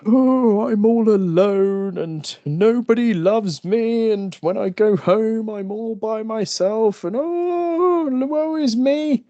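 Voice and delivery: very high, slow wailing mock misery; drunk self-pity. Sad drunk whining to herself, mock wail. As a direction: ham up the tearful drunk. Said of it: "going down the sad lines".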